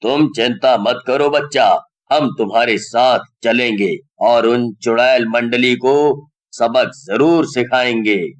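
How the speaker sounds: low, deep calm holy man; sage's voice. A narrator's reading. Old sage reassuring Rohan he will help. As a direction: reassure the boy with calm authority. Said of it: "बाबा कहते हैं".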